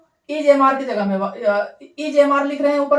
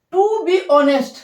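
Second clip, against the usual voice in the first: To be honest drawn out slowly and loudly, each word clear; slow teaching demo; 7 semitones higher, 6 dB louder, much slower than usual. Shown as a demonstration. Teacher to students, introducing the phrase, slow and loud. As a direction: spell the phrase out slowly for the class. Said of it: "तो इसका अर्थ है".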